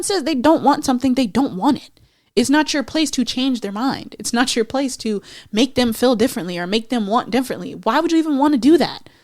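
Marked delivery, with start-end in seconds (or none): none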